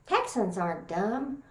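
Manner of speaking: ordinary talk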